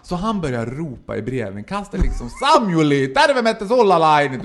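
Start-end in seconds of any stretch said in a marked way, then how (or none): 2.3-4.4 louder calling through the letterbox; shouting in Finnish. Retold or imitated. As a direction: bellow it like an angry father at the door. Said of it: "Du ropar något på finska". (ropande på finska)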